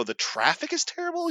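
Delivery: very high, disbelieving echo; mock incredulous question. Son echoing dad's traffic complaint, acted incredulity. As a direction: squeak it back in acted disbelief.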